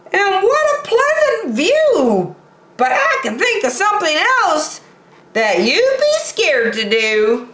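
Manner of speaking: high boastful buffalo voice, fast in the middle; a cocky high-voiced buffalo throwing down a dare; Boris Buffalo boasting. Boris Buffalo daring Bear to something scarier, high boastful voice.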